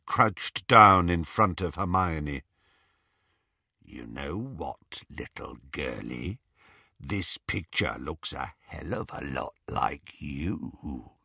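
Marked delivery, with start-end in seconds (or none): 3.8-11.1 menacing werewolf. A narrator's reading. Greyback leaning over Hermione, low and menacing. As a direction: purr it like a beast toying with prey.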